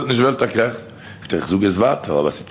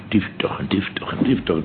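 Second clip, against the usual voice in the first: hushed whispered refrain; 3 semitones lower, 5 dB quieter than usual. Acted. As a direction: drop to a breathy secret whisper.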